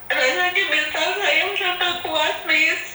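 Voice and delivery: real tearful plea, pitched high; crying. Real, not acted. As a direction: voice lifted by crying. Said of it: "kenapa harus nangis".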